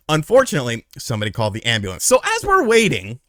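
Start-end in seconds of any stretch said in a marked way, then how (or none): none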